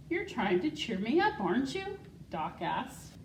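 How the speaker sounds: put-on reading a picture book to children; story reading. School secretary reading Wonder Horse to the class, storytelling voice. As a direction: read it warmly and clearly to young listeners.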